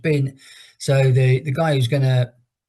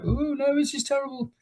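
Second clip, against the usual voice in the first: much higher and softer, imitating a distraught girlfriend; mock upset girlfriend; 14 semitones higher, 7 dB quieter than usual. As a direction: play the panicked girlfriend, pitched way up and hushed. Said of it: "She's like".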